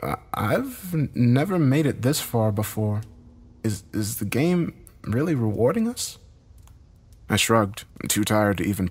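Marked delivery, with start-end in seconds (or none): 0.0-6.2 higher-pitched voice, stammering in disbelief; halting, uncertain character voice, pitched up; muttering, faltering woman. A narrator's reading. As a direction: stumble into the words, voiced high. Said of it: "as Heather muttered".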